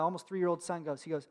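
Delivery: ordinary delivery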